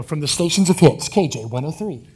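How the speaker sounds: imitating a station announcer; lightly voiced radio promo; radio jingle voice. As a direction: put on a big radio ident voice. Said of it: "Like that, okay?".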